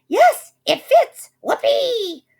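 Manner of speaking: very high, loud gloating squeal; gleeful scream. A narrator's reading. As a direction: screech in greedy triumph. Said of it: "But she screamed".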